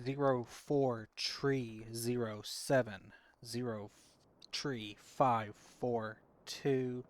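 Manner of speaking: loud, slow digits, each punched; emphatic radio readback with falling beats. Real, not acted. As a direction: read the grid back deliberately, number by number. Reads grid back over radio.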